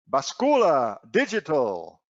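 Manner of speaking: slow, hammed-up Mexican accent. Presenter reading a Spanish search term aloud, mock Mexican accent. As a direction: draw it out in a put-on Mexican accent. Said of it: "That's my best Mexican accent".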